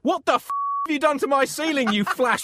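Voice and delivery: high, loud hammed-up outrage; outraged shout. Angry Pope confronts painter over the ceiling, outraged shout. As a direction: explode in comic fury.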